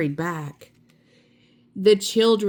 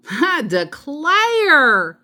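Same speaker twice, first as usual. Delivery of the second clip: high, louder, slow "I declare"; delighted surprise; 9 semitones higher, 6 dB louder, much slower than usual. A narrator's reading. Amelia Bedelia opening the book, slow and delighted. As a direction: savour the surprise. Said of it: "she said".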